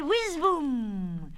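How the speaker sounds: high whizz into a long boom; firework noises. Acted. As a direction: sound like a rocket taking off. Lenny plays a firecracker.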